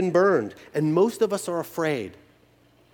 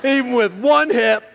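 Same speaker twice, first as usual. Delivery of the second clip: mock bragging; 7 semitones higher, 8 dB louder, slower than usual. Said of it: "And I'm like".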